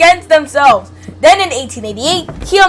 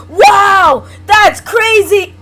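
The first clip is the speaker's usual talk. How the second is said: loud, high exclamation of wonder; hammed-up astonished outburst; mock amazement; 6 semitones higher, 4 dB louder, much slower than usual. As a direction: blurt it out in exaggerated awe, drawing out the end. Mock amazement while reading article.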